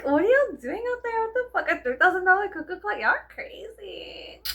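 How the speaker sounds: high, loud, incredulous outburst; hammed-up shock at the price; mock disbelief. Vlogger imitating her shocked reaction to sellers, high and loud.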